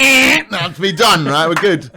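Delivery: high, loud, slow lazy workman. Retold or imitated. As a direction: play the workman clocking off. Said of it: "and went".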